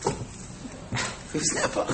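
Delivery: slow, breathless whisper. Acted. To the audience, joking about the endless poker game, slow hushed whisper. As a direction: let the words drop to a hush.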